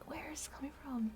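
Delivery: baffled whisper to herself; whispered aside. Real, not acted. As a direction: drop into a hushed, quick whisper. Whispered aside about her own story.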